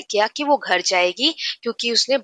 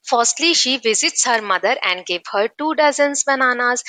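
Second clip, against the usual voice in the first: slow, careful textbook reading; slow reading aloud; 4 semitones higher, much slower than usual. Shown as a demonstration. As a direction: read each word out slowly for the class. Reads English text slowly aloud. (धीमे-धीमे पढ़कर सुनाना)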